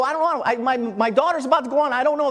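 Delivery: high, flustered retold excuse; frantic reply. Late father to the ticket ladies, frantic reply. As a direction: re-enact a rushed, panicky answer.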